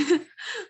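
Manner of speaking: short light laugh; a bright little laugh. Real, not acted. Teacher laughs at fumble.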